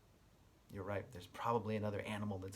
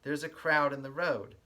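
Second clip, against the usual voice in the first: lively storybook read-aloud with a sing-song lilt; reading to children; 6 dB louder than usual. Acted. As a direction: read it like story time for little kids. Reads picture book aloud.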